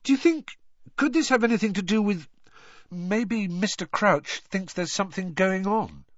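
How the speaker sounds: high, halting questions that restart; Harry, tentative schoolboy. A narrator's reading. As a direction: grope for the question, then hurry it out.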